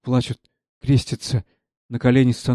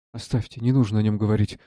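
ordinary talk

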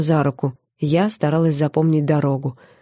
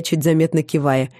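plain reading voice

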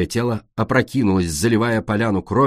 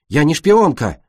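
loud, indignant protest; protest, offended; 5 semitones higher, 5 dB louder than usual. A narrator's reading. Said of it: "возмутилась Цунами".

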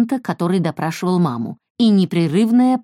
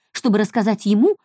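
usual speaking voice

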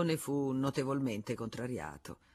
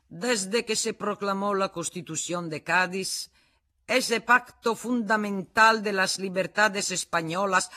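loud, raised oratory in Spanish; pompous speech; 4 semitones higher, 6 dB louder than usual. Acted. The Spanish patriot orating to the club, loud and raised. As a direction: orate grandly at the crowd.

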